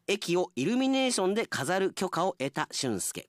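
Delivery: usual speaking voice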